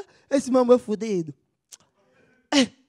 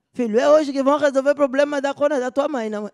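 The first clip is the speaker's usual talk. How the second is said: loud and higher, oblivious; loud father; 5 semitones higher, 7 dB louder, faster than usual. Acted. Father loudly asks his son in the consulting room, booming. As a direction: boom it across the room without shame.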